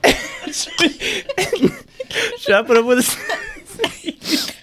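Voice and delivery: slow, giggling through words; barely holding back laughter; stifled giggles. Real, not acted. Giggling repeat of the joke.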